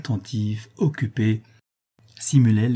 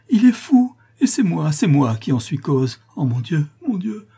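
high, loud, frantic outburst; anguished crying out; 8 semitones higher, 6 dB louder than usual. A narrator's reading. Daughter blames herself for father's madness, anguished cry. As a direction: wail with guilt and horror. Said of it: "s'écria-t-elle".

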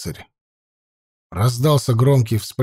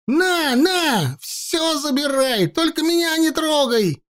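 высокий перепуганный крик; вопить от страха, умоляя; испуганный вопль; slower than usual. A narrator's reading. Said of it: "завопил Уча". (перепуганный вопль)

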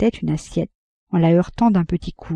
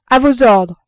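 loud, higher defiant shout; furious challenge; 5 semitones higher, 7 dB louder than usual. A narrator's reading. Le chevalier défie le postillon, furieux. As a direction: throw down the challenge. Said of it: "deux voix furieuses s'élevèrent".

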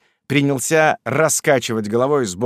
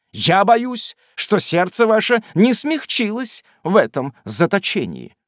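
pompous preacher; 8 semitones higher, 4 dB louder than usual. A narrator's reading. Stiggins continues pompously.

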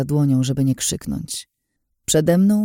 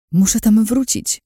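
ordinary delivery

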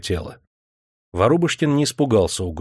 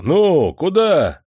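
soldiers barking higher and louder; rough yelling; 8 semitones higher, 5 dB louder, much slower than usual. A narrator's reading. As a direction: shout at the prisoner to hurry. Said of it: "покрикивали на Андрейку". (солдаты покрикивают на арестанта)

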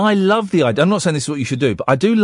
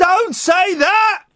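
shout; much slower than usual. Real, not acted. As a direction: cry out in alarm.

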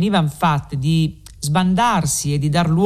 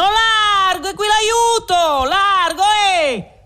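shout for room; 14 semitones higher, slower than usual. A narrator's reading. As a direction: bellow it over the mob. Men with ladder shouting through crowd. (grido acuto e forte, è qui l'aiuto)

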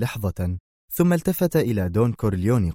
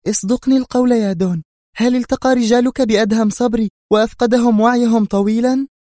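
high, louder woman's voice, pressing hard; nervous Sonia; 13 semitones higher, 6 dB louder than usual. A narrator's reading. Sonia suddenly presses the Don for the truth, nervous. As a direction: demand the truth, edgy and urgent. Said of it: "سألته بغتة في عصبية".

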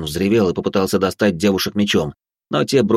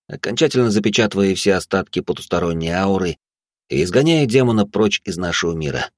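plain reading voice

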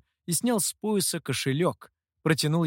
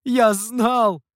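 loud, high triumphant exclamation; raised, gleeful outburst; overjoyed; 7 semitones higher, 7 dB louder than usual. A narrator's reading. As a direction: cry out with vindicated delight. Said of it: "снова обрадовался хозяин".

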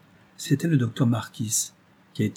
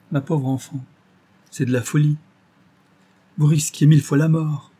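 rising, louder alarm, higher by the end; deeply moved outcry; 5 semitones higher, 5 dB louder than usual. A narrator's reading. Narrator scolding the blind girl for her risk, moved and louder. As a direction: cry out in moved dismay. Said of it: "m'écriai-je, profondément ému".

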